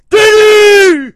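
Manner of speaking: screamed way up high; shouted name. Acted. Host shouting out his girl to listeners, high scream. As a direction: scream the name like a hype man.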